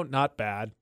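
low, soft, falling reply; downward inflection. Shown as a demonstration. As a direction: let it sink low and quiet. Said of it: "let me do my normal voice".

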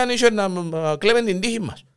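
usual speaking voice

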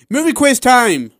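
high, loud game show call; loud announcement. Real, not acted. Host announces the quiz segment.

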